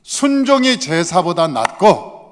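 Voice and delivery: loud, slow, hammered words; angry rebuke. Acted. Samuel rebukes Saul with the famous words, angry. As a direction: hit each word hard as a reproach. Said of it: "이렇게 화를 내서 얘기하는".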